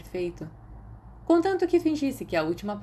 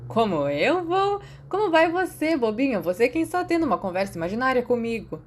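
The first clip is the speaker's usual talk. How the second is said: higher, mocking friend's voice; teasing Grant; 5 semitones higher, 4 dB louder than usual. Imaginary friend teasing Remus, higher mocking voice. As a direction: toss the question back with a tease.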